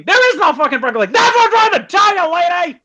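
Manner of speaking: high, loud comic outburst; acted fed-up yell, voice shooting up; exasperated shout. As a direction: lose all patience with the customer. Joke grocer finally loses patience.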